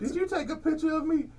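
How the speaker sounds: lightly voiced accusing girl; imitating the girl. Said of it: "The girl come up to me talking about".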